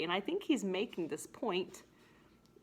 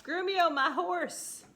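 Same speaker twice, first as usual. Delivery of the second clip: high, slow, loud commanding Petruchio; lightly hammed-up bossy husband; acting out Petruchio; 10 semitones higher, 5 dB louder, slower than usual. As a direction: bark an order at the servant for the horse. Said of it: "And he's like".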